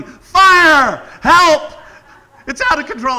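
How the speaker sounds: high, loud cry of "Fire! Help!"; mock shout. Shown as a demonstration. What he should have yelled at the fire, loud shout. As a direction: yell it like an alarm. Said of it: "I wasn't yelling".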